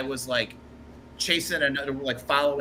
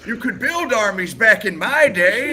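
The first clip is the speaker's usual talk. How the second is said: old man impression; 11 semitones higher, 7 dB louder than usual. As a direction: join in as the old man boasting of his day. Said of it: "like, a little old man".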